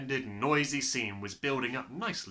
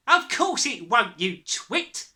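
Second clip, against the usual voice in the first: high, slow put-down; loud scorn; 11 semitones higher, 9 dB louder, slower than usual. A narrator's reading. Mother dismissing her husband's hope, scornfully. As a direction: scoff loudly, dropping on the insult.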